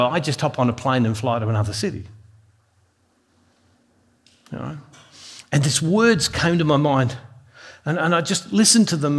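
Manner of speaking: usual speaking voice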